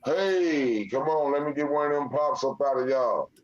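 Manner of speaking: calling out. Real, not acted. Host asking y'all for pops, calling out.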